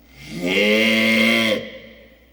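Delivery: a huge loud yell that breaks and cracks apart; a loud, breaking scream from the tractor seat; voice cracking holler. Retold or imitated. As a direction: let the scream crack and give out. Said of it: "and my voice broke".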